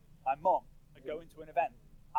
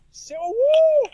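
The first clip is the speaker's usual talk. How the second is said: high, loud, drawn-out woo of delight; real delighted whoop from the stage; excited whoop; 14 dB louder, slower than usual. Real, not acted. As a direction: cheer the answer out loud. Host cheers a big family.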